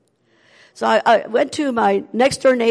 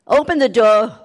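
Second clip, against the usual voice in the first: loud call; 3 semitones higher, 8 dB louder than usual. Retold or imitated. Woman retelling visitor at door.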